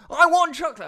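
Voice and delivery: put-on high, loud child voice; high childish demand for chocolate; little girl whining. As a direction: whine it like a small daughter. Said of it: "and she goes".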